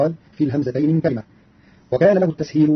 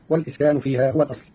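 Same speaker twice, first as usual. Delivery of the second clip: normal talking voice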